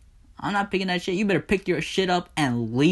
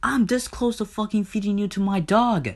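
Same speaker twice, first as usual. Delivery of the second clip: furious tirade; 9 semitones higher than usual. Acted. Hades rages at Persephone over incest, furious tirade. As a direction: tear into them with escalating threats.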